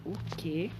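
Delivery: ordinary delivery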